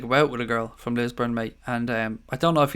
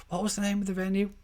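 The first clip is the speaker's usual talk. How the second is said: higher, softer, half to himself; puzzled musing; 7 semitones higher, 7 dB quieter than usual. Real, not acted. Streamer asking his chat to recall the venue, softly puzzled. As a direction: drift up into a soft wondering tone.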